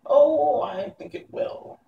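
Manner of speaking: high, slow, sly wicked glee; cheerful witch. Acted. Witch Mombi cheerfully answering frightened boy Tip, high and slow. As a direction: answer sweetly with menace. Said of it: "answered Mombi cheerfully".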